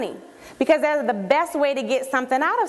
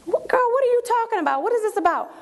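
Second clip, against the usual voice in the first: high-pitched, incredulous questioning; baffled friend; 9 semitones higher than usual. Acted. Friend answering the excited invitation, high and bewildered. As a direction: demand to know what on earth this is. Voicing the puzzled friend's reply.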